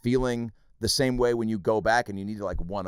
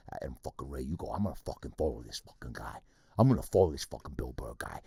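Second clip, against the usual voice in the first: lower, softer gruff mock Burr voice; a hint of Bill Burr ribbing a younger comic; imitating Burr; 9 semitones lower, 5 dB quieter than usual. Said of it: "and he goes".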